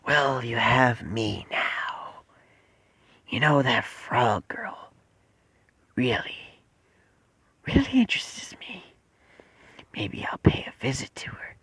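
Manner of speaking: slow, whispered creature voice; hushed, drawn-out whisper with menace; the Bat. Acted. Creature threatens to visit the frog girl, sinister whisper. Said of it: "And Bat goes".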